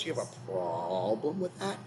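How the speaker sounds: a hint of an angry creepy gangster; creepy angry tough guy. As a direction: growl it like an angry street tough. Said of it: "Said in a creepy, angry A-man voice".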